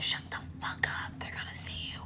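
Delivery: hushed, voiceless whisper; urgent whisper. Acted. Telling careless movie characters to shut up, urgent whisper. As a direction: whisper it so the killer won't hear.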